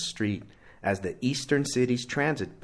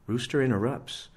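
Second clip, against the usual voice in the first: plain reading voice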